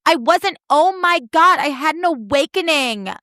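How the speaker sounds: put-on whiny self-defence; whiny, defensive. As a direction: imitate an indignant protest way up in pitch. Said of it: "so defensive and so whiny".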